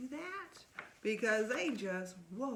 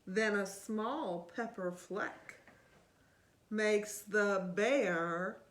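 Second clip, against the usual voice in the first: put-on lively read-aloud to a child; storybook reading; 2 semitones lower, 6 dB louder, slower than usual. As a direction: read it like bedtime story time. Reading picture book aloud.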